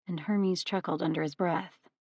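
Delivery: ordinary delivery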